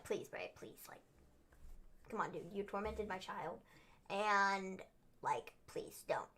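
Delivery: hushed, breathy begging "please"; imitating a desperate plea in a whisper; mock pleading whisper. Podcast host imitating The Miz begging Bray, whispered. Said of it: "The Miz at first was like".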